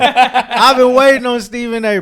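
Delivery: excited. Real, not acted. Excited about the quote.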